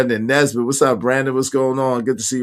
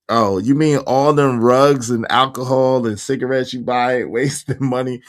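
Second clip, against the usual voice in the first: ordinary delivery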